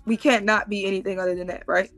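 ordinary talk, nothing marked

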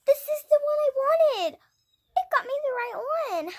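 high, loud, overjoyed gasp; delighted cry. Pretend. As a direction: squeal with delight at the gift. Thrilled at the headband.

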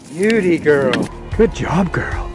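loud, high praise; excited. Real, not acted. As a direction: cheer it like a proud owner.

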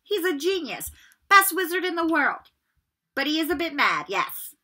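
Percy brushing the question aside; airy. A narrator's reading. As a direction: toss it off lightly. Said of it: "Percy airily".

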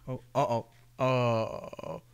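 normal talking voice

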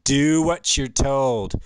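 very loud, slow order; booming, drawn-out command; barked command. Acted. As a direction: hammer each word out as an order. Acted command to the pawn.

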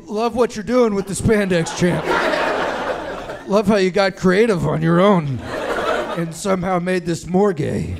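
loud, overdone praise; forced-cheerful dad. Retold or imitated. Dad loudly praising his son's tightened Ken spandex. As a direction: pump up the fake enthusiasm. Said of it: "my dad just had to be like".

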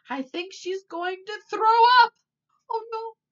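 high, loud alarmed wail; alarmed cry. A narrator's reading. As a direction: shout the warning in alarm. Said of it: "cried Missus Sweeting".

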